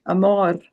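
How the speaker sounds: "amor" ending on a tapped, rolled R; rolled Spanish R. Shown as a demonstration. Teacher showing Spanish R to colleagues, rolled. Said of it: "'cause they roll their tongue".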